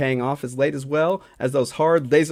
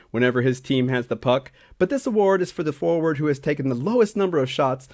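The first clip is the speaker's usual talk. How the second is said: normal talking voice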